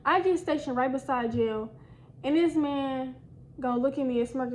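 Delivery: normal talking voice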